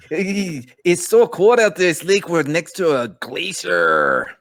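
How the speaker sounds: put-on high, muddled New Zealand accent; hammed-up New Zealand voice sliding into pirate. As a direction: pipe it high in a shaky attempt at New Zealand. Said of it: "supposed to be New Zealand".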